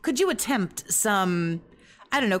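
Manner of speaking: ordinary talk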